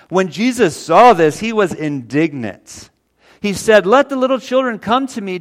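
fervent sermon delivery; full-force preaching. Acted. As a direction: proclaim it to the back row. Preaching Jesus' words at full force.

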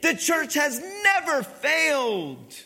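loud, high, slow proclamation; full-force sermon climax; triumphant preaching cry. Real, not acted. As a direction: ring it out to the congregation, stretching every key word.

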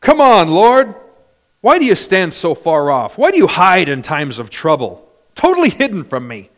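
loud, exasperated complaint to God; David. Acted. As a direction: cry out in protest, acting an abandoned psalmist. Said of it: "Would you like to hear David in Psalm ten".

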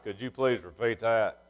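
a light imitation of a slow Southern churchgoer; Southern drawl impression. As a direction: lightly mimic a Mississippi country fella. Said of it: "he literally sounded like that".

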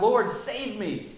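slow, high, loud plea; desperate cry. A narrator's reading. Peter sinking in the waves, a slow high desperate cry. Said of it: "he cried out".

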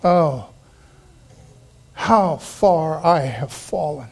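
slow, heavy, dejected admission; ashamed lament. Acted. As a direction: confess it slowly with head hung. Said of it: "we hang our heads".

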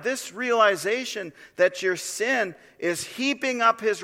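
high, loud, fervent warning from the pulpit; full-force preaching. Acted. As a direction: thunder the warning at the congregation, slowing on the hard lines. Preacher warns of God's wrath.

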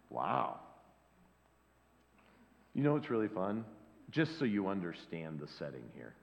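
ordinary delivery

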